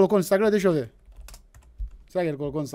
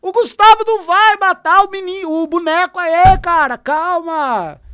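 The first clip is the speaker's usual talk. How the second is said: higher, louder mock soothing; mocking shout; 7 semitones higher, 8 dB louder than usual. Real, not acted. Streamer telling the debater to calm down over a doll, mocking loud. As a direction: yell calma like he's throwing a tantrum.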